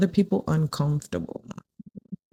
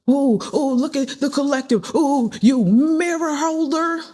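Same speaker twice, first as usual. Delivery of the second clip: mocking taunt; 6 semitones higher than usual. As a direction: tease them like a sulking rival. Mocking exposed person.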